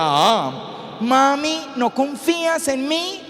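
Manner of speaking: high, rising wheedle to mom; pleading teenager. Acted. Teenager protests to mother, acted. (adolescente suplicante, voz aguda y quejosa)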